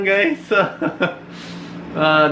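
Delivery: normal talking voice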